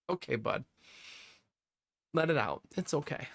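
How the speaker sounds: put-on soft, gentle comfort to a crying child; soothing dad. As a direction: ease him down quietly.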